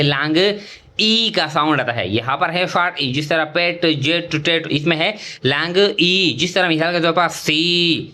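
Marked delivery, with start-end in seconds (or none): none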